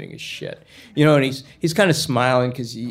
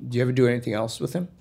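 retold cop slipping the question in quickly; quick casual question; much faster than usual. Cop questioning the kid about Cleveland, quick and offhand. Cop's first probing question.

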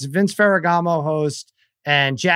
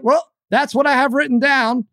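higher, matter-of-fact mock host; imitating Jackie Slater; 9 semitones higher than usual. Retelling the host's excuse in a higher mock voice. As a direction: shrug it off like a host reading notes. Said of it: "He's like".

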